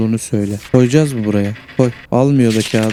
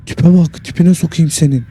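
loud, high shocked outburst; 5 semitones higher, 4 dB louder than usual. Real, not acted. Gamer sees a creepy kid, loud shocked outburst. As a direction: blurt it out in disbelief.

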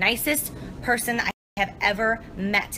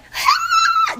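loud, very high mock cackle; imitated laughing; 8 dB louder than usual. Teller mimics herself laughing, oblivious to the warning, imitated laughing. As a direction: re-enact a loud shrieking laugh. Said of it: "But I'm just like".

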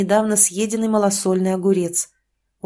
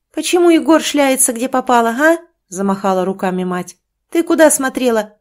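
angry berating; 4 semitones higher, 5 dB louder than usual. Acted. Mother berating daughter.

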